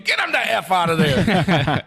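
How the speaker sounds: retold sideline bark through laughter; mock coach yell. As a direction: coach Hug yelling from the bench, mock fury. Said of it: "you see Hug saying".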